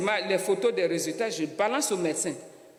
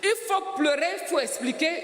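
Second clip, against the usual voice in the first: raised voice, insisting she must speak up; urgent insistence; 7 semitones higher than usual. Retold or imitated. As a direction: urge her hard to cry for help. Doctor urging her to cry out.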